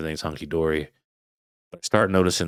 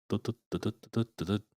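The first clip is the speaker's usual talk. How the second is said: soft, quick dut-dut imitating thuds on the roof; thudding noises; 3 semitones higher, 8 dB quieter, faster than usual. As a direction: tap out the running thuds under your breath. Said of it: "thudding noises".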